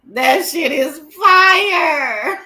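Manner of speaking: slow, loud drawn-out fire; loud hype; excited. Real, not acted. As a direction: stretch it with joy. Hyping show.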